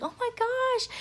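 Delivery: very high-pitched gushing; thrilled. Retold or imitated. Passenger congratulated by a flight attendant, thrilled and high. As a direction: re-enact a squeal of delighted disbelief.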